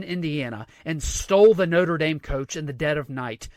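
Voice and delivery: ordinary delivery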